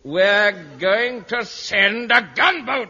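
slow, high, loud build to the last word; hammed-up dramatic announcement; grand proclamation. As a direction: declaim it like a momentous battle order. Dramatic announcement after false start.

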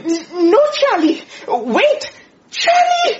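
very high desperate cry of his name; frantic shout. Acted. Boss crying out to stop the apprentice, very high shout. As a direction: yell to stop him before he throws. Stopping Charlie throwing spanner.

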